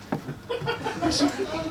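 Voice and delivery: high, voiceless acted gasp; mock recoiling gasp. Waiter stepping back from farting diner, mock appalled gasp. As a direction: gasp as if hit by a stink.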